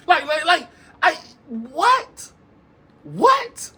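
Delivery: high, squeaky rising disbelief; laughing stunned disbelief; incredulous what. Real, not acted. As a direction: shriek the what in shock. Incredulous at obvious scheming.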